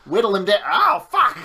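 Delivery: rising to a loud high shriek; frustrated yell. Real, not acted. Attack fails during battle.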